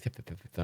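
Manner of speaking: soft unvoiced thup-thup flicks, then a hiss; snake tongue flicks. Imitating the snake Eli, soft tongue flicks. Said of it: "He's like".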